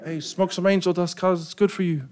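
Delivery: put-on older teens' voice. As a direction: coax him like it's nothing. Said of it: "and they're like".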